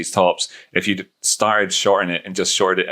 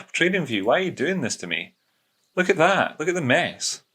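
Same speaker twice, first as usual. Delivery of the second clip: higher, softer hammed-up lament at the software; mock despair; 9 semitones higher, 3 dB quieter than usual. Trader scolding TradingView for a messy chart, mock anguish. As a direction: plead with the app like it betrayed you, pitched up.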